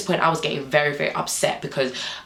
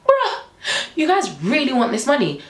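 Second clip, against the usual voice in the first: high-pitched exasperated disbelief; incredulous; 8 semitones higher than usual. Retold or imitated. Rider reacts to officers following for money, high-pitched disbelief. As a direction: start way up high, stay raised.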